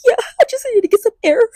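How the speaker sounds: high, fast blubbered answer; exaggerated tearful squeal; mock crying. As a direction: rush it out through pretend sobs. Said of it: "tears falling down my face".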